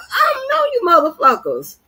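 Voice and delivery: high, laughing mock sob, hammed-up; mock crying. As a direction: whine it like a fake crybaby, laughing through it. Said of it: "crying and shit".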